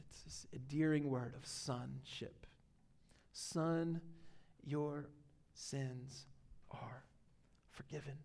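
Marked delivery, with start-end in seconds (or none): 3.3-8.2 very soft, slow, words spaced apart; fading, hushed tenderness; tender. Acted. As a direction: let each word fall gently like a blessing. Jesus' words, tender, drawn out.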